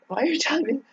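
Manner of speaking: exasperated what-the-heck question; incredulous. Retold or imitated. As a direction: squeak the question in mock shock. Retold baffled reply to married guy.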